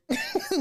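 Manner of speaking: bright high giggle; laugh. Real, not acted. Host laughing at her viewers' teasing, giggle. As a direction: giggle at her own joke. Catching herself mid-tease.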